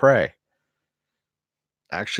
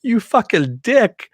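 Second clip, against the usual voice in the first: high, loud, slow indignant yell; angry outburst; 13 semitones higher, 6 dB louder, slower than usual. Retold or imitated. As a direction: yell it at the prankster, outraged. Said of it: "everyone's like".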